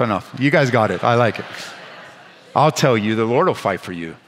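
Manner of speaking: usual speaking voice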